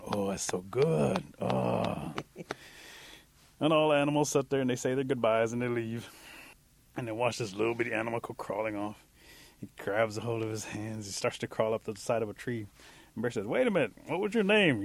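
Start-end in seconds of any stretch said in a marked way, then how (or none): 0.0-2.1 slow, stuffed, satisfied groaning; contented full-belly groan. Acted. Little creature patting its tight belly, contented slow groan. 13.5-14.8 high-pitched questioning call; calling after someone. Acted. Bear to the creature crawling away, calling out a question.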